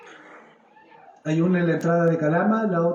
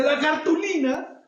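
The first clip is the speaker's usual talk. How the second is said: high, slow mock jolt of alarm; playful imitation of a startled child; startled awake; 8 semitones higher, slower than usual. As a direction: blurt it like a kid waking up in a fright. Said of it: "se despertaba asustado".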